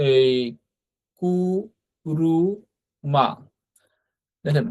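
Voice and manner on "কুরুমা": কুরুমা drawn out slowly, ku-ru-ma; slow model word. Shown as a demonstration. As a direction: stretch কুরুমা syllable by syllable for learners. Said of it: "কু এর উচ্চারণ". (কুরুমা ধীরে টেনে টেনে উচ্চারণ)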